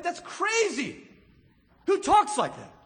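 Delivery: "Who talks like that?" thrown up high and loud in disbelief; incredulous outburst. Acted. Pastor marveling at Jesus' words, high and incredulous.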